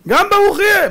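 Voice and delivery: high, loud exclamation; helpless outcry. Acted. Isaac telling Esau the blessing must stand, loud and helpless. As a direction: throw it out loudly, voice shooting up.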